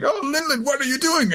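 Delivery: put-on mother finds child in ruins, high dismay; shocked mother. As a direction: gasp it up high. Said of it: "Mother Carmel's like".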